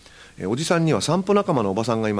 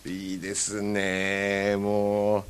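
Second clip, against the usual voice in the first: ordinary delivery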